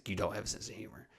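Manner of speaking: quiet jab. Retold or imitated.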